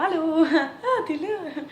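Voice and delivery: high, giggly fake-surprised hello; awkwardly acted surprise with a laugh; feigned surprise. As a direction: pretend you only just noticed them. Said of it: "je fais semblant que je l'ai pas vu".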